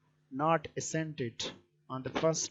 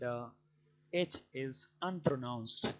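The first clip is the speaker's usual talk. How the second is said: usual speaking voice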